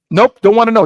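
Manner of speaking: high, loud dismissal; emphatic refusal. Retold or imitated. Refusing to hear the second choice, emphatic.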